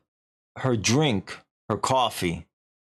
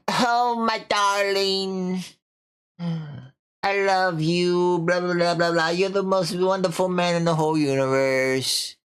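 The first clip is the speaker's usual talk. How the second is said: mock lovestruck woman, higher and slow at first; swooning wife; 6 semitones higher than usual. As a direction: ham up a gushing lover. Lovestruck wife gushing to husband.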